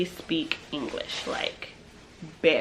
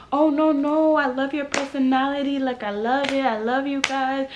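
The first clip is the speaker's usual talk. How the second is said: higher, effusive reassurance; gushing dealer; 6 semitones higher than usual. Retold or imitated. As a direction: imitate an over-friendly hustler, pitched up. Acts out gushing, insistent dealer.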